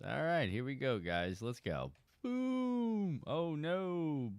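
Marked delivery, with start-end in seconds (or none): 2.2-3.2 high boom effect. Acted. Launching the boat, sound effect.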